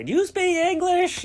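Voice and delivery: hammed-up, high, loud, drawn-out tourist question; stupid American tourist. American tourist barging in on locals abroad, hammed-up and clueless. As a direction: ask it slowly and loudly like a clueless American abroad. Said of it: "they just think you're a stupid American".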